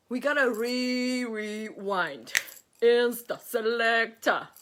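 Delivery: playful garage MC call. Acted. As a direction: stretch the rewind, then punch out the selector. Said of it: "a little bit of [name]".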